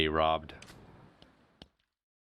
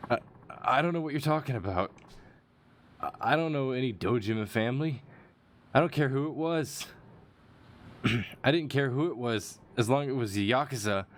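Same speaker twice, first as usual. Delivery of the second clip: higher, hurried character voice; switched character voice; 5 semitones higher than usual. Acted. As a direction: pitch it up and rush the defence. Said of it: "Let's just switch the voice up a bit".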